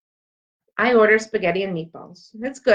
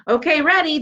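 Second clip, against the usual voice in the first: mild shout; 8 semitones higher, 3 dB louder than usual. Acted. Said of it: "Jenny shouts".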